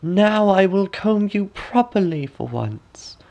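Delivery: high, slow sly crone; slow high crone, falsely kind; old woman. A narrator's reading. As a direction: purr it like a wicked old hag. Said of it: "the old woman said".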